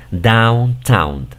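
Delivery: downtown with down and town each given full weight; individual parts joined. Shown as a demonstration. Teacher models a prefixed word for learners, downtown said as two joined parts. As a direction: model downtown carefully, both parts fully voiced. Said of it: "como si fuera de manera individual".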